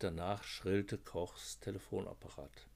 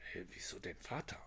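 puzzled hushed whisper; 8 dB quieter than usual. Acted. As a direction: breathe the question, baffled.

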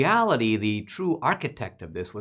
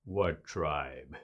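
low, soft, slow hostile voice; a suspicious Klansman at the door, menacing and quiet; man behind the door sizing up a stranger, low and slow; mock Klan leader; 7 semitones lower, 10 dB quieter than usual. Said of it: "imaginative rendition".

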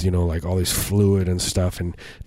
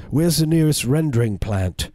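mock Australian accent; 7 semitones higher than usual. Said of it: "this accent".

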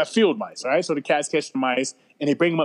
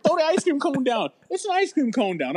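higher-pitched imitation of mom ordering; mother's order; 10 semitones higher than usual. Mother telling her eight-year-old to drop the cone, higher mock voice. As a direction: squeak out mom's command. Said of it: "my mother's like".

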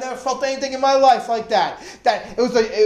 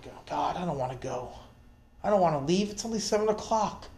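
much softer, lower pleading prayer; quiet prayer; 4 semitones lower, 11 dB quieter than usual. Retold or imitated. Praying silently at dinner not to be taken home, soft plea. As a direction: murmur it as a private plea. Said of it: "I remember praying".

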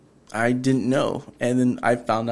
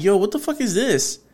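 high, curious what the fuck is this; genuinely baffled, pitched up; puzzled exclamation; 9 semitones higher than usual. Retold or imitated. Retelling finding something on the dance floor, baffled.

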